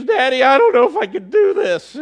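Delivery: put-on high, loud, laughing little-girl plea; daughter's voice. Daughter to her dad after the race, high laughing plea. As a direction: squeak it out like a worn-out kid. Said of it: "She goes".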